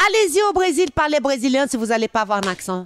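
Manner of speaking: high-pitched, indignant comeback; challenging retort. Retold or imitated. Talk show guest answering critics of her accent, challenging retort.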